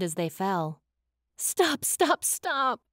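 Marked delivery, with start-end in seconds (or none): 1.4-2.8 high, panicked cry in narration; shrieked. A narrator's reading. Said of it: "Raven's brain shrieked at her".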